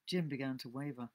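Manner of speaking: ordinary delivery, nothing marked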